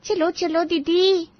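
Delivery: ordinary talk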